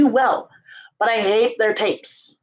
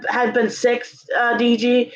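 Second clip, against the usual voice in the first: normal talking voice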